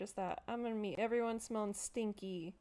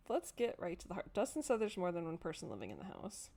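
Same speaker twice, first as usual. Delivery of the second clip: normal talking voice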